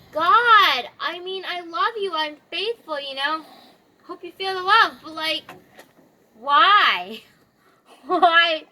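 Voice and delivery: mildly hammed-up plea, loud on key words; pleading protest. Job questioning God in their quarrel, pleading protest. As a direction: imitate a hurt believer asking why.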